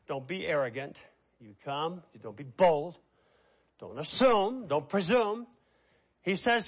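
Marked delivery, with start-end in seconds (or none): none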